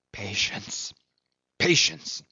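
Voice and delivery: grit it out to himself; a muttered vow, rising on the second patience. A narrator's reading. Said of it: "he muttered".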